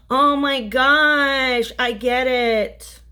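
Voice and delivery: loud, high and slow with a gasp; light dawning, drawn out; sudden realization. Real, not acted. Sudden insight during reading.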